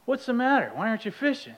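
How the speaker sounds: high-pitched parental questioning; concerned dad. Retold or imitated. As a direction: coax a crying child to explain. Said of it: "And I say".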